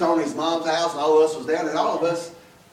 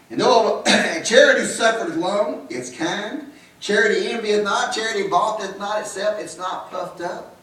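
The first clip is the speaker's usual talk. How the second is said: higher, ringing recital of the verse; scripture recitation; 6 semitones higher than usual. Acted. Preacher reciting the charity verses to the church, higher and ringing. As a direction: proclaim each line of charity.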